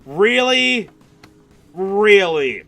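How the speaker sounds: loud, drawn-out cry of disbelief; exasperated shout. Real, not acted. Gamer let down by the secret area, exasperated shouting. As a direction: shout it out, high and falling.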